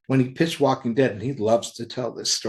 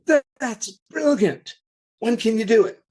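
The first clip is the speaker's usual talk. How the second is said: higher, louder, thrilled outburst; excited reaction; 10 semitones higher, 3 dB louder than usual. Retold or imitated. Publisher reacts to writer's big plot reveal, excited. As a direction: act out being bowled over by a pitch.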